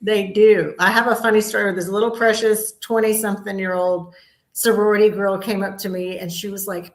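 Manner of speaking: usual speaking voice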